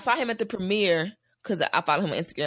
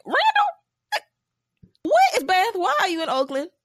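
much higher, louder, squeaky disbelief; shocked squeal of recognition; 12 semitones higher, 4 dB louder, slower than usual. Retold or imitated. Fan seeing a TV actor in the movie, much higher and louder startled shriek. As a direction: shriek his name like you can't believe it's him. Spotting the actor on screen, retold.

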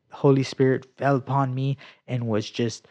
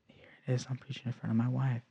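soft, much quieter inner voice; hushed realization; 10 dB quieter, faster than usual. Retold or imitated. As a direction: think it aloud under your breath. Awed inner thought, retold.

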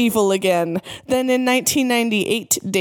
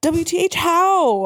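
high, stretched, incredulous squeal; mock scream; 9 semitones higher than usual. A narrator's reading. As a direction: shriek HOW without full force. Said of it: "I screamed".

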